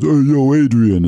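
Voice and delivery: slow, drawn-out hack boxer impression; Rocky. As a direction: stretch out a comic's Rocky call. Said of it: "you sound like Rocky".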